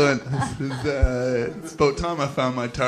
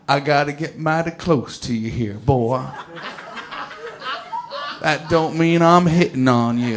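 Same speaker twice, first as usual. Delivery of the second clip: Southern security leader. Retold or imitated. Security leader at a naked pat-down, in a slow Southern drawl. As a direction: lean in like a macho American guard. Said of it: "and he goes".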